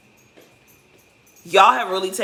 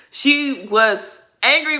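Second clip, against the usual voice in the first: slow, louder, higher She was angry; drawn-out emphasis; slow emphatic; 7 semitones higher, 8 dB louder, much slower than usual. Real, not acted. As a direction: stress each word. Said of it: "emphatic telling".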